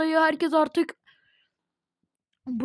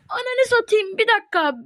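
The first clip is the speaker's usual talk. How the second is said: stunned exclamation; 7 semitones higher, 4 dB louder than usual. Real, not acted. Shocked by the drop.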